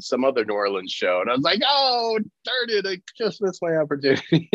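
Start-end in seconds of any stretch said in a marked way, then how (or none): 1.7-4.5 mock dismay. Retold dismay at cancelled shows.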